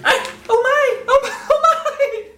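very high squeaky falsetto, slow; hammed-up high lady voice; bad female voice. Shown as a demonstration. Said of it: "I'm just a bad female voice".

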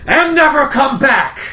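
loud, high yell of banishment; furious in-character shout; angry shout. Acted. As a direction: yell it as you throw someone out. Man throwing youth out the door.

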